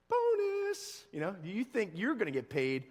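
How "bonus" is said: sudden high, bright cry of glee; excited cry. Retold or imitated. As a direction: shout it like you just hit the jackpot. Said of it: "you're like".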